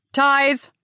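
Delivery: loud call to a servant; calling the servant, strong and loud. Acted. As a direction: call for Ties across the house. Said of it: "she called".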